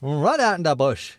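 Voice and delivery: loud mock New Zealand bush accent; hammed-up New Zealand accent. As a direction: put on a broad New Zealand twang, loudly. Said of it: "from New Zealand there".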